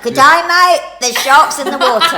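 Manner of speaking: loud, laughing Aussie attempt; Australian accent. Shown as a demonstration. Nanny finally trying the whole line, loud Australian. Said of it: "Try the whole line".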